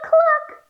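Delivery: high-pitched chicken cluck; hen cluck. Shown as a demonstration. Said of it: "instead of saying moo, let's say cluck".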